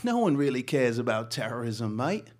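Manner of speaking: retold brisk London editor, British accent; clipped British newsroom accent, lightly imitated; British Fleet Street accent. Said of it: "With his British Fleet Street accent".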